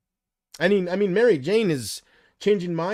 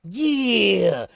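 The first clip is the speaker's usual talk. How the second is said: voodoo prisoner gloating; 5 semitones higher, 3 dB louder, much slower than usual. Retold or imitated. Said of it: "he's poking it with pins going".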